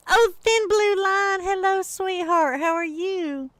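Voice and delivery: high, slow, sugary hello to a viewer; sing-song greeting for a chat friend; sweet greeting. Real, not acted. Greeting a viewer mid-story.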